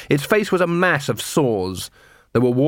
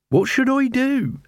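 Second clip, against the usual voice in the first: high, drawn-out plea; worried beggar queen; 13 semitones higher, slower than usual. Acted. Beggar queen asks the watch for advice, high plea. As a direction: plead in a high beggar woman's voice.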